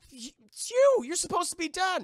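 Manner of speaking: very high, loud cry of disbelief; shocked crew. Acted. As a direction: gasp it out as if seeing a ghost. Crew shocked to see Jack.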